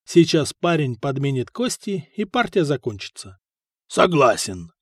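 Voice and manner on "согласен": low-voiced gruff agreement; deep, brief assent from a warrior; Hakon. A narrator's reading. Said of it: "сказал Хакон".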